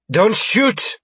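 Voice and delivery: high, panicked plea; sudden high cry of alarm; alarmed stammer. A narrator's reading. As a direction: plead as a frightened hostage. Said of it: "Marie stammered".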